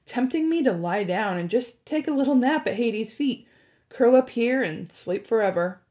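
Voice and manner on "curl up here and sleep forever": ordinary delivery, nothing marked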